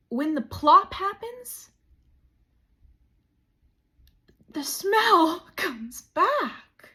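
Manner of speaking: milk the reveal, drawing it out; loud comic reveal. Acted. Big comic reveal, laughing.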